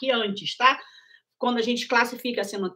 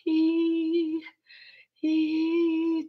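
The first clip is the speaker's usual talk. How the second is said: slow voice with a slight leak of air, like a vocal fold gap; demonstrating a light breathy voice for patients; breathy voice demo; 4 semitones higher than usual. Shown as a demonstration. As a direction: show a slightly breathy, leaky voice. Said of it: "a pessoa já vai fazer uma voz".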